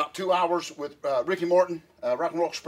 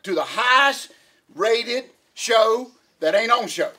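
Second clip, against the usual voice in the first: show host welcome; 5 semitones higher, 6 dB louder than usual. Acted.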